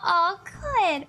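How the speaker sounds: ordinary talk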